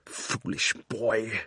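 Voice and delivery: soft, slow cold scorn; a hushed, icy rebuke; scornful queen. Acted. Queen Jadis scorning Diggory, quietly cold.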